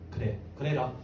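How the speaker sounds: low, soft granting of permission; quiet assent. Acted. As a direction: grant it briefly, voice dropped. 예수님의 짧은 허락. (낮고 조용하게 허락하는 말투)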